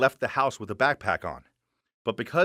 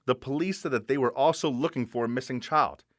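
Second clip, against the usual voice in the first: usual speaking voice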